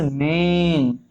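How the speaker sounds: मेन with the vowel held long; मेन drawn out slowly as a pronunciation model; demonstrated long vowel. Shown as a demonstration. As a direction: stretch the e in मेन. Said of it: "pronounce करोगे इस तरीके से".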